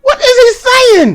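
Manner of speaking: very high exasperated cry; bewildered yell. Retold or imitated. Yelling dude appealing to the line, bewildered yell. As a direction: cry it out high, baffled and fed up. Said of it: "the dude that was yelling".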